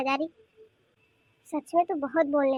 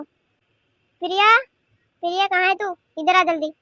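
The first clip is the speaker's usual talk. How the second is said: loud summons to a granddaughter; raised voice, ordering someone over; calling out; 3 semitones higher, 6 dB louder than usual. Acted. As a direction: call her in sharply, drawing out the name. Grandmother summons Priya, out of sight.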